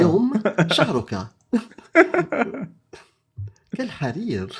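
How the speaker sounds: light, sing-song advert announcer; shampoo ad voice. Pretend. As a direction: sell it like a Middle East shampoo ad. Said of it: "Tu fais bien les pubs de merde".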